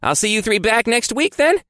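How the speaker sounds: high hopeful guard voice; eager guard voice. Acted. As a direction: ask it like the keen young guard. Guard voiced in narrated story.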